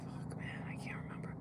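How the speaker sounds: very soft, voiceless muttering; frustrated whisper. Real, not acted. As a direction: curse under your breath, trying to remember. Stuck on a forgotten word.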